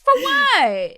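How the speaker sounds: indignant outburst. Real, not acted. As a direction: fling the question at them in disbelief.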